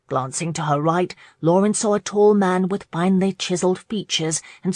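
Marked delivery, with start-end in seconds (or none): none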